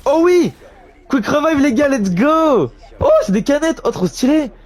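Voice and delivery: high, loud gamer delight; thrilled shout over a new perk; excited. Real, not acted. Streamer hyped to his viewers over a perk, loud excitement.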